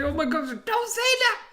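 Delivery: high, squealed mock girl voice; mock offended girlfriend; shrill girl. Girl reacting in retold scene.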